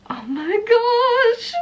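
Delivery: high, slow awestruck gasp; stunned at first sight. Real, not acted. Opening her book proof.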